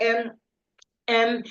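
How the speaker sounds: hesitant, halting um, um; nervous filler ums. Shown as a demonstration. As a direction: stall like a nervous speaker. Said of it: "you're like".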